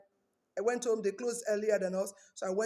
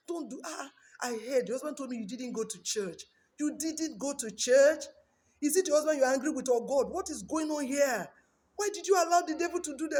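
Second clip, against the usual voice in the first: raised, higher pastor's rebuke; scolding pastor; 6 semitones higher, 4 dB louder than usual. Retold or imitated. Pastor rebuking the wife for skipping church, raised and scolding. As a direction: scold her like a stern pastor.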